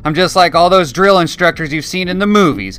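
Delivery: high, loud, colorfully abusive tirade; abusive drill sergeant. A narrator's reading. Drill sergeant berating new recruits on the tarmac, loud and abusive. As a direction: tear into the platoon with contempt.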